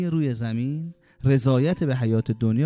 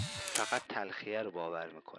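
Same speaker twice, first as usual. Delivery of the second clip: softer echo; 5 semitones lower, 16 dB quieter than usual. Shown as a demonstration. Repeated line, much softer.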